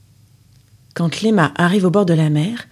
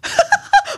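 evil cackle. Acted. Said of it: "méchant sourire".

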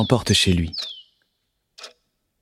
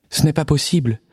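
higher, fretful mother bird; worried little bird voice; 6 semitones higher than usual. Acted.

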